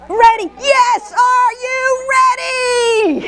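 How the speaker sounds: rally the room like a game-show host; hyped-up cheer. Shown as a demonstration. Trainer pumping up the audience, high, loud, laughing.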